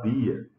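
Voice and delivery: "dia" with a hard d, no j sound; pronúncia pernambucana. Retold or imitated. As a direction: quote the Pernambuco "dia", like "dee-a". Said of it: "que estranho". ("dia" com d dental, sem chiado)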